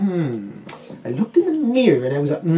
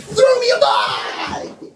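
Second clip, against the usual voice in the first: high yelled dinosaur voice; excited shout; slower than usual. Retold or imitated.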